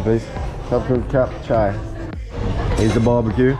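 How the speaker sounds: ordinary talk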